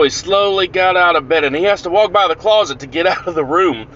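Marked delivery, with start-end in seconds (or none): none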